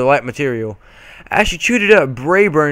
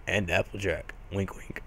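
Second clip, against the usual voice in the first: sly aside; 7 semitones lower, 17 dB quieter than usual. Real, not acted.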